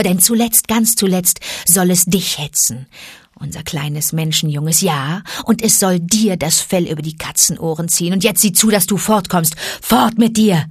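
fierce she-wolf. Acted. Mother Wolf drives off tiger.

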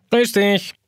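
blubbernd, undeutlich. A narrator's reading. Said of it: "blubbert der Kalender undeutlich".

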